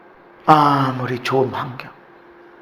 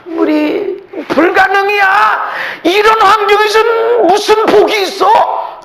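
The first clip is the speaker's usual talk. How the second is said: despairing doubt; 6 dB louder than usual. Acted. Preacher voices despairing doubt.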